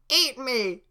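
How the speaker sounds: high shrieking defiance; gingerbread man voice. Acted. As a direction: scream it right back. Gingerbread man defying Farquaad.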